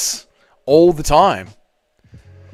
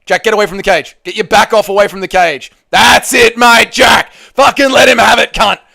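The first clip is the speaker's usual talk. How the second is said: loud, urgent yelling of instructions; shouting at the screen; 4 semitones higher, 3 dB louder than usual. Real, not acted. Streamer yelling instructions at the TV, loud. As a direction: bark orders at the fighter.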